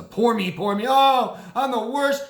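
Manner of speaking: high, loud mock wail; hammed-up poor-me lament; self-pitying mother. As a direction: imitate a mother playing the victim. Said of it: "like, mothers like that".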